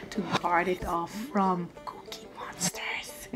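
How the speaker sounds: playful conspiratorial whisper. Real, not acted. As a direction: breathe it like a secret. Playful aside about cookie thieves.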